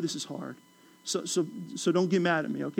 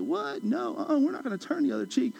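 mock disbelief; 7 semitones higher, 4 dB quieter than usual. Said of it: "we were all like".

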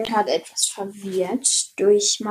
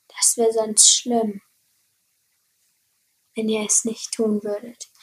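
hushed mock threat whisper. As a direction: whisper it like a secret warning. (geflüstert)

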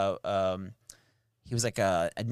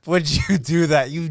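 retold angry shout; 8 dB louder than usual. Retold outburst at friend.

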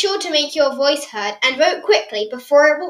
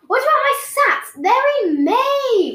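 wail; 12 semitones higher, 7 dB louder than usual. Acted. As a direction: wail it like the world is ending. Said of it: "wailed Ivy".

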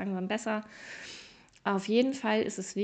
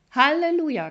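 much higher and louder mock cheer; sarcastic hallelujah; 7 semitones higher, 9 dB louder than usual. Real, not acted. Podcaster mocking a weakened immune system, loud sarcastic hallelujah. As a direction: throw it out as ironic praise.

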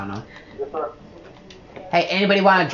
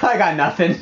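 laughing; 3 semitones lower than usual. Real, not acted. Laughing at himself.

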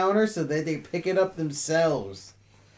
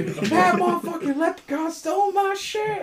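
mock outrage; 7 dB louder than usual.

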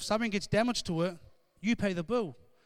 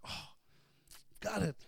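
softer, mild hint of tearful dismay; retold crying; 4 semitones higher, 4 dB quieter than usual. Girl upset the gingerbread man left, softly mock tearful. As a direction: lightly act her upset, hushed. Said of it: "She starts to cry".

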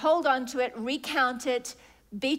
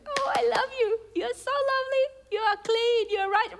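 much higher, louder, gushing with love; doting loving voice; 11 semitones higher, 4 dB louder than usual. Acted. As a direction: coo over them like a delighted father. God's loving voice, acted.

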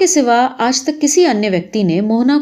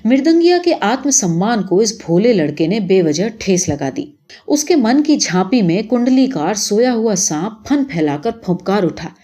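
ordinary delivery, nothing marked

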